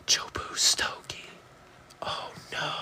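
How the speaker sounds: hushed, voiceless whisper; whispered mock dread. Host reacting to Jobu on stream, dramatic whisper. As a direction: whisper it in playful alarm.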